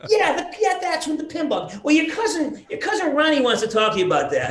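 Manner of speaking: high, loud chattering mom; imitating mom. Son recalls mom handing phone to cousin, high loud mom voice. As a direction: rush on excitedly as his mother.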